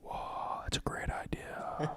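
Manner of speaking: slow, soft whispered approval; hushed mock amazement; playful ASMR whisper. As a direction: whisper it slowly into the mic. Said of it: "A S M R".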